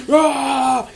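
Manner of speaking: high drawn-out yell; mock hero cry. Imitating Indiana Jones running, high mock yell. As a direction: let out a put-on straining cry. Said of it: "He's like".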